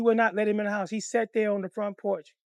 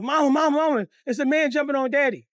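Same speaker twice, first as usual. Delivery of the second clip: loud, high cry for Mama; acted alarm, pitched up and loud; retold panicked cry; 7 semitones higher, 4 dB louder than usual. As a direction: yell out like a scared child. Retells his panicked childhood cry.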